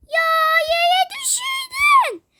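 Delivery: high, slow wailing shout at the friend; shocked shout. Acted. Child wails at friend who dropped jelly bean. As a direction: cry out that it fell.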